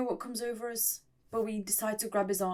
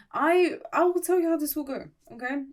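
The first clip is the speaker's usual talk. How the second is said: excited; 5 semitones higher, 6 dB louder than usual. Real, not acted. Excited prediction to viewers.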